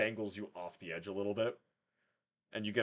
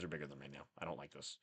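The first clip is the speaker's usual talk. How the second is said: sulky quiet; 8 semitones lower, 13 dB quieter, faster than usual. Acted. Complaining about being smaller.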